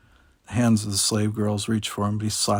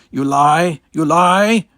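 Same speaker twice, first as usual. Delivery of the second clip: loud, high shouts climbing higher; bellowing king; 7 semitones higher, 10 dB louder, much slower than usual. A narrator's reading. King accuses the envoy twice, escalating enraged bellow. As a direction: shout it, then push it even higher. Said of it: "King Badalion bellowed".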